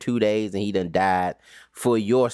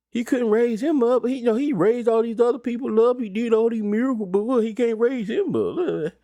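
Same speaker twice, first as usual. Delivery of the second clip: mock the crowd's disbelief; 9 semitones higher than usual. Preacher mimics doubting onlookers, high mocking voice.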